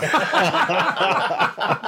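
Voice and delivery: high laughing moan; hammy moan laugh. Shown as a demonstration. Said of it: "we'll do".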